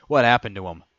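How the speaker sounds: understated scream of alarm; mild scream. A narrator's reading. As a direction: cry out in alarm, not at full force. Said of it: "Dino screamed".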